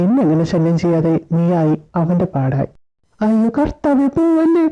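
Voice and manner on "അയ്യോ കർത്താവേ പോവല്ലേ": mock wail. Begs God not to leave.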